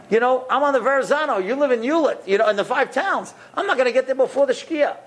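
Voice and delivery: high-pitched, insistent objection; flustered protest. Retold or imitated. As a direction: argue it can't be done, voice held high. Retold protest that it is impossible.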